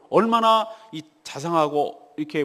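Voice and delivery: usual speaking voice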